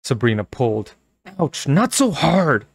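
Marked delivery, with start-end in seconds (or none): 1.4-2.6 high, slow pained protest; pained cry. Acted. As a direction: cry out in pain. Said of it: "Carly Beth cried".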